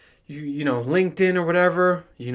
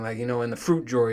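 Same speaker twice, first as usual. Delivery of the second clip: normal talking voice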